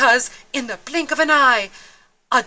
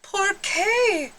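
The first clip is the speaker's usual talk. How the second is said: puzzled Scipito; 10 semitones higher than usual. Acted. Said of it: "asked Scipito".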